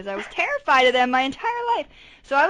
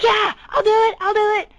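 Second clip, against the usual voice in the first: much higher, eager and bouncy; eager mock yes; 11 semitones higher, 6 dB louder, faster than usual. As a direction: ham up the family's eagerness. Eager family quoted.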